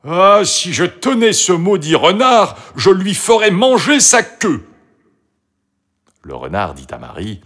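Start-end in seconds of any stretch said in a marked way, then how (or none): 0.0-4.7 high, loud indignant grumble; grumbling angrily. A narrator's reading. Toutou comes back cursing the fox, grumbling. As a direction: curse him, pitched up and loud. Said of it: "en grommelant".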